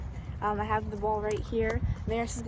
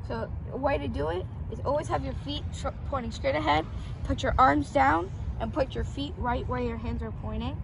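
loud coach on the field; football coaching; 3 semitones higher, 6 dB louder than usual. Real, not acted. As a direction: bark instructions like a coach. Brother coaching football loudly.

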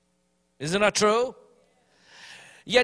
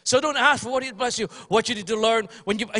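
usual speaking voice

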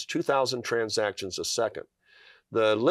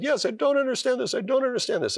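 much higher, echoing the doctor's words in wonder; much higher amazed repeat of the doctor's words; 13 semitones higher, faster than usual. Retold or imitated. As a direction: lift it way up, stunned it's coming true.

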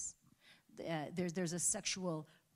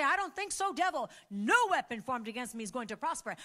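loud, higher, daring the devil to try; defiant rebuke; 7 semitones higher, 11 dB louder than usual. Pretend. Believer rebuking the devil, loud defiance. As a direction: throw it in the devil's face. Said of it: "and you say".

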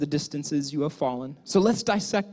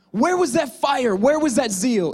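higher, louder preaching; fiery challenge; 6 semitones higher, 3 dB louder than usual. Real, not acted. Preacher challenges Peter before the church, loud and fiery. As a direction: drive the question home. Preacher presses the question loudly.

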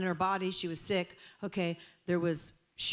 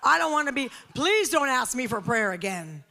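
loud, high, comic plea to escape; exasperated pleading; 7 semitones higher, 9 dB louder than usual. Retold or imitated. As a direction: beg off loudly, half laughing. Acted plea to be left alone.

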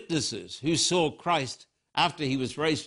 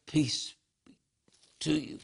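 softer, calm and reassuring; gentle blessing; 4 dB quieter than usual. Retold or imitated. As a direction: speak peace over frightened friends. Risen Jesus greets frightened disciples.

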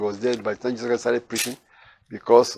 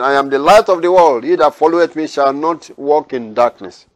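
ringing, raised reading of the Bible; scripture reading; 2 semitones higher, 4 dB louder than usual. Acted. Preacher reading aloud to his congregation, proclaiming. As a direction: proclaim each verse to the congregation. Reads scripture at full force.

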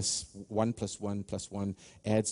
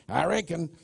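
southern accent; 6 semitones higher than usual. Shown as a demonstration. Pastor trying a southern accent for the congregation. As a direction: say "reckon" like a Southerner, up high. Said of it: "try and do it with a southern accent".

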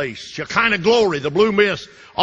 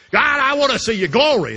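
loud pleading cry; 10 semitones higher, 4 dB louder than usual. Acted. Moses pleading to God.

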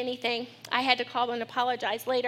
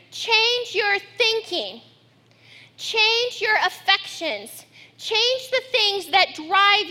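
slow, higher, punched preaching lines; emphatic preaching; 5 semitones higher, slower than usual. Acted. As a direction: drive each command home from the pulpit.

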